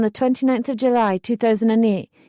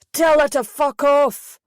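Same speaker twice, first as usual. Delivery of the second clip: loud, slow, higher shout from the background; 5 semitones higher, 4 dB louder, slower than usual. A narrator's reading. Brother shouting at his sister from the background, loud slow shout. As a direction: bellow it from across the room. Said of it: "I could hear Jason shouting".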